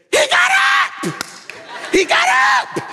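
extremely high, loud acted shriek; ecstatic shout. Old church mother answering the preacher, ecstatic shriek. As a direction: scream it out in bursts of praise.

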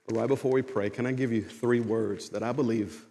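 low, soft, measured altar-call voice; tender pastoral ministry. Real, not acted. Preacher giving words from God before prayer, soft and tender. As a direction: speak gently with long, weighty pauses.

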